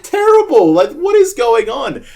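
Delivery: loud, high hammed-up outrage; YouTuber reaction. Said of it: "reacting like I'm on fucking YouTube".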